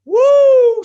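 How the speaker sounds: very high, loud whoop; Ric Flair woo. Retold or imitated. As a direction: throw in the wrestler's woo. Ric Flair catchphrase mid-sentence.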